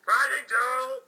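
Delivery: loud, high call; loud high duel shout; battle cry. Acted. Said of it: "they both said".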